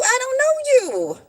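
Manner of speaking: high, loud brush-off of a stranger; indignant. Real, not acted. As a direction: scoff at the stranger. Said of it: "rejecting a stranger's lecture".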